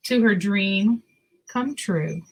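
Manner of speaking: put-on reading a picture book to children; story narration. A narrator's reading. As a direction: tell it gently to little listeners.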